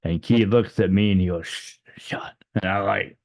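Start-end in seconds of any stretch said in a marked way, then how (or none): 1.5-2.3 urgent whispered shush; hushing whisper. Retold or imitated. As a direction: whisper it to shut someone up. Shushing someone to be silent.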